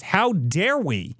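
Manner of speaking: high, slow mocking "how dare we"; mock outrage. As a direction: sneer it like an offended elite.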